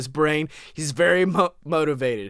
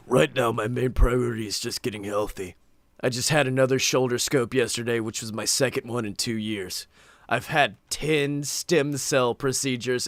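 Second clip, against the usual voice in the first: mock Ryback read; 6 semitones lower than usual. Reading the wrestler's quote, lower mock voice. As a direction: read it as the big guy.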